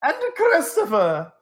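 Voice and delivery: pompous olde-English showman, high and loud; hammy stage actor. Acted. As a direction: ham it up like a bad Shakespearean actor. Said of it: "I was making fun of Gavril".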